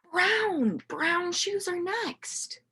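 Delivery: gasp, then high, slow, soft "brown"; hushed mock amazement; gasping reveal. A narrator's reading. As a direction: act astonished for the class. Dramatic color reveal.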